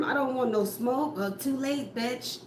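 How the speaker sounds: normal talking voice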